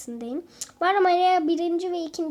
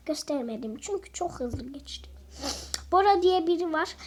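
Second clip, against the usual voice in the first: ordinary talk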